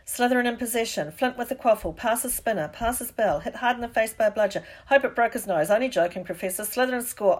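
urgent match announcer's projected voice; live commentary. A narrator's reading. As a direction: commentate briskly over the crowd. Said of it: "Lee was still commentating".

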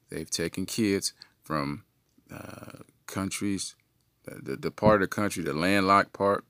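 ordinary talk

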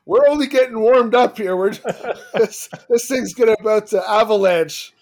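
loud, high burst of laughing excitement; gleeful laughing. Real, not acted. Gleeful reaction to next coach topic.

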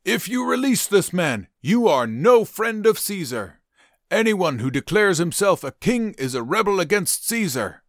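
high, loud threatening shout. Acted. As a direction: warn Pilate at full voice.

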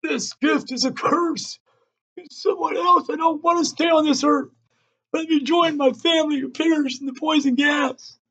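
high-pitched anguished screaming at the sky. A narrator's reading. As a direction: scream it up to the heavens in despair. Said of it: "shaking his fist and screaming in the wind".

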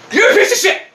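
loud, high-pitched yell at a driver; acted road-rage yell. Driver has to beep at the car ahead, acted angry yell. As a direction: let fly like you're leaning on the horn. Yelling at stalled driver when honking.

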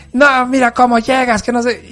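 mother scolding her son for how he comes home; mock scolding mom.

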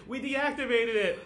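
high-pitched, gleeful retold cheer; mock triumph. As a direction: celebrate in a high, laughing voice. Voices character's triumph in retold scene.

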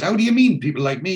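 high, loud acted protest; indignant challenge. Neville challenged over people like him, indignant challenge. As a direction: imitate someone taking sharp offence.

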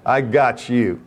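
slow, laughing mock threat to a short CEO; mock tough guy. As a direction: drag it out with a chuckle, playing tough. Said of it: "and I was thinking".

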